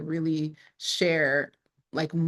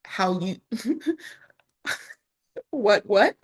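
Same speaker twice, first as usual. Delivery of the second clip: ordinary delivery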